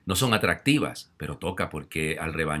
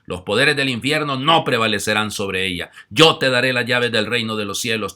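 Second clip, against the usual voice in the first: Gospel reading; 3 semitones higher, 6 dB louder than usual. Acted. Priest reading the Gospel aloud to the congregation. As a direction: proclaim it from the ambo.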